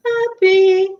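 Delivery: bright high pop on the word; sudden jump up into cheer; mock cheerful. Shown as a demonstration. Coach contrasting anger, then chirpy happy.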